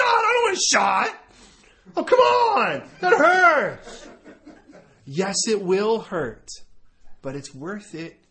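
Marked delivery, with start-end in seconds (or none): 0.0-4.1 put-on much higher, louder childish protest; mock whiny shout. [name] refusing the shot, mock whiny shout. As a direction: whine like a kid scared of a needle.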